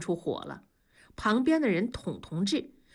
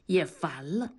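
ordinary delivery

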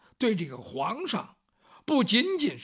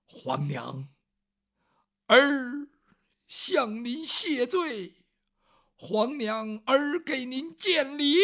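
皇帝谢罪; 4 semitones higher, slower than usual. Acted. 皇帝跪下向生母谢罪.

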